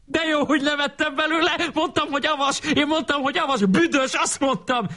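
very high schoolboy squealing, no added volume; hammed-up shrieky outburst; excited yelling. A narrator's reading. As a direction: cry out in a rush of high protest. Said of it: "kiabált Andrási".

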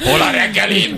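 high, laughing demand; mock hungry elephant. Host voices the elephant asking for breakfast, laughing mock demand. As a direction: whine for food through giggles.

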